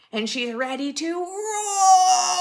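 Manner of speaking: loud, high bear roar; loud roaring narration. A narrator's reading.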